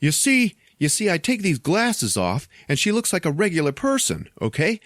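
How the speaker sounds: high-pitched, animated hero impression; demonstrating to onlookers. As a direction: explain it excitedly to a crowd. Acting the hero's demonstration to onlookers.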